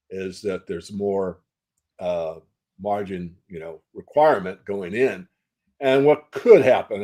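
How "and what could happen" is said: could pushed up and louder; stress on could. Shown as a demonstration. Interviewee stresses a possibility, not a certainty: could hit firmly. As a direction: lean hard on could, not would. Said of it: "I want to emphasize the word could".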